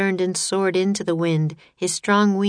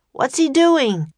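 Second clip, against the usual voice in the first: raised, loud alarmed question; Jack crying out; 8 semitones higher, 6 dB louder than usual. A narrator's reading. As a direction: cry out the question, pitched up. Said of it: "cried Jack".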